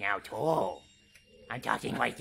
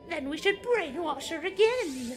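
villain voice. Pretend. Voicing villain in game.

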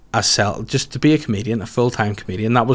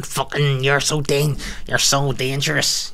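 mock [name]; 2 semitones higher, 3 dB quieter than usual. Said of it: "like [name]".

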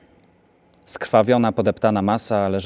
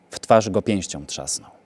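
usual speaking voice

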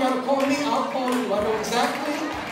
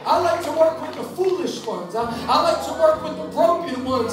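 preaching; 5 semitones higher, 5 dB louder than usual. Acted. Preacher builds again.

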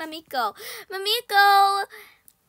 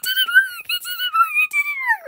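shrill wail; 8 dB louder than usual. Real, not acted. Player watching the plan fail, high stretched wail. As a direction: wail it out in despair.

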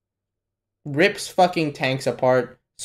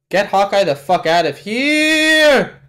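raised yell, last word stretched long; urgent yell; 5 semitones higher, 4 dB louder, slower than usual. Real, not acted. Viewer urging Hawkeye's rescue, urgent shouting.

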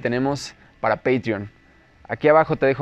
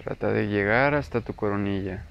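slow, softer, lower meditation voice with long pauses; guided meditation; 3 semitones lower, 11 dB quieter, slower than usual. Acted. Teacher leading listeners into breath awareness, guided meditation. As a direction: guide the listener unhurriedly through breath and body.

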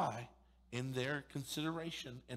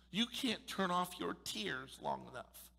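ordinary talk, nothing marked

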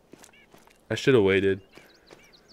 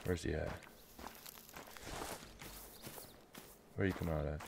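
much softer, low and hushed; hushed hunter; 5 semitones lower, 20 dB quieter, much faster than usual. Real, not acted. Hunter stalking his target, muttering under his breath. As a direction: keep it down so the prey won't hear. Stalking prey, keeping quiet.

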